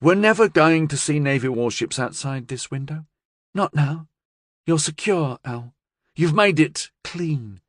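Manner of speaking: high-pitched woman briskly reassuring her boss; confident gangster's partner. A narrator's reading.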